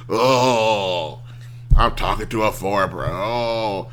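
groaning, put-upon American complainer; hammed-up groan of annoyance; mock exasperation. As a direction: moan it like a spoiled caller, mockingly. Mocking Americans griping at foreign support.

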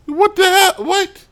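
outraged disbelief. Real, not acted. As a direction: squeal it in disbelief.

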